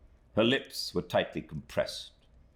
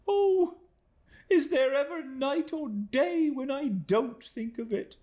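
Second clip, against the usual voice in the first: put-on much higher, louder desperate young woman; crying out for mercy; 3 dB louder than usual. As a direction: cry out begging for mercy. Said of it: "cried Emily".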